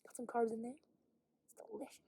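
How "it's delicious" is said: whisper. Real, not acted. Whispered aside about snacks.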